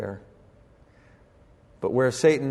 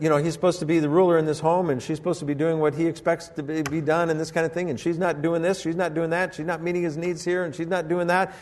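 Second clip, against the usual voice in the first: usual speaking voice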